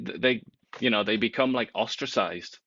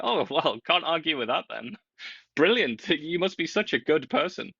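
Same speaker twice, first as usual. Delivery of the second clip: high, laughing mock approval; sarcastic praise; 6 semitones higher than usual. Host mocks a self-righteous group with sarcastic praise. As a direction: gush with fake admiration.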